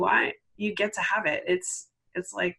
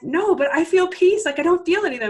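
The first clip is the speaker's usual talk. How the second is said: high, louder burst of disbelief; amazed client insisting; 10 semitones higher, 7 dB louder than usual. Retold or imitated. Coach voices a client amazed at feeling peace, high astonished insistence.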